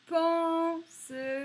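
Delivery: teacher sounding out Pansement; slow, sounded-out word. Shown as a demonstration. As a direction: stretch both en sounds in Pansement. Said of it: "on a les deux".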